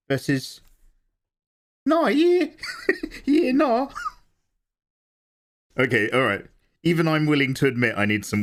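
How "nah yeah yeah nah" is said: put-on crank the Kiwi accent to the max; broad Kiwi accent. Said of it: "Ten out of ten Kiwi".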